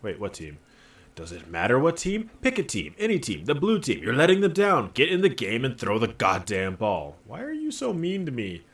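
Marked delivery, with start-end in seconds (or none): 1.1-7.2 rising, louder impatient coaxing; exasperated urging. Acted. Inner voice urging the player into the game, exasperated. Voicing an exasperated pep talk while reading. 7.3-8.6 high, wounded little complaint; mock hurt. Player answering a bullying pep talk, mock hurt.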